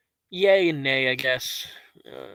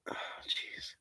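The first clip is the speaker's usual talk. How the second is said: weak sigh; 16 dB quieter than usual. Real, not acted. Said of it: "I feel like I'm gonna throw up".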